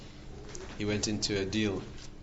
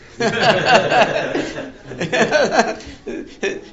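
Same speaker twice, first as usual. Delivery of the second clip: big laugh; 11 semitones higher, 8 dB louder than usual. Real, not acted. Telling of the wealthy buyers.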